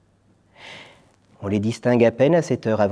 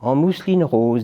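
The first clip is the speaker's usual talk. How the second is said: fat lady; 8 semitones higher, 4 dB louder, slower than usual. Acted. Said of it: "dit la grosse dame".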